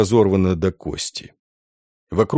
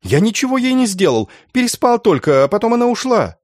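very high, pleading denial; strained, high-pitched excuse; desperate outcry; 11 semitones higher than usual. A narrator's reading. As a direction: protest frantically as the accused. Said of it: "выкрикнул тот".

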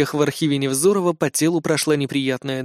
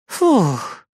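relieved sigh; 4 semitones higher than usual. Acted. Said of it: "С облегчением выдохнула".

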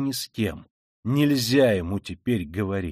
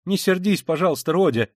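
flustered, pleading higher then sinking; embarrassed mother hastily appeasing; 6 semitones higher, 4 dB louder than usual. A narrator's reading. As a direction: plead anxiously to smooth things over. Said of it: "заговорила в смущении Пульхерия Александровна".

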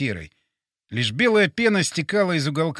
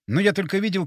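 plain reading voice

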